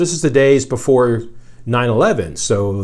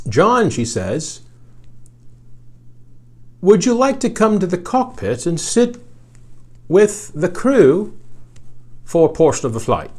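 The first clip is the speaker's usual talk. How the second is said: a deliberately bad Australian accent, pitched up; hammed-up Australian accent, inviting a passenger up to the cockpit; 7 semitones higher than usual. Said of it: "Excuse my bad Australian accent".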